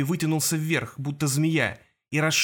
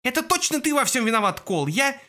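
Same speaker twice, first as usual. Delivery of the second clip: frantic shouting hostage taker; 9 semitones higher than usual. A narrator's reading. Jasper keeps yelling in panic.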